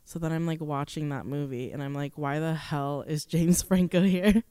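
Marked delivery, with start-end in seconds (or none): none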